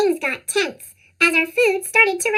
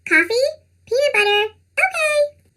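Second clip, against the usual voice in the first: squeaky cartoon voice; 7 semitones higher than usual. Neighbors asking for groceries.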